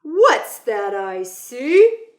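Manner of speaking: loud, slow, playful driver voice; a showman bus driver teasing a kid; booming playful bus driver; Mister Dennis. Acted. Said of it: "Mister Dennis asked".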